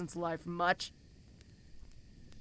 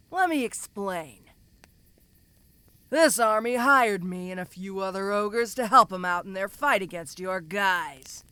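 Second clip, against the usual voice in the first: normal talking voice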